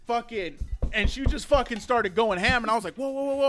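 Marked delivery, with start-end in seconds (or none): 2.9-3.5 alarmed whoa. Retold or imitated. Stopping the masseuse.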